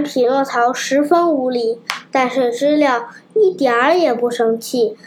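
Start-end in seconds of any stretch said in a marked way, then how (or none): none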